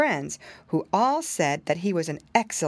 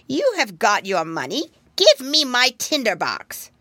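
rising, louder witch demand; 4 semitones higher, 6 dB louder than usual. Acted. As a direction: insist like a greedy old witch.

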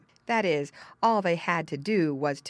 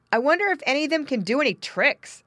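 put-on high, louder child's question; curious boy; 10 semitones higher, 6 dB louder, faster than usual. Boy looking into pen, curious. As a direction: ask like an eager little boy. Boy wonders about pigs.

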